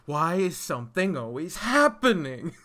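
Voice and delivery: loud, exasperated outburst; exasperated yell. Retold or imitated. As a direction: yell it like Nora, fed up. Nora exasperated, quoted loudly.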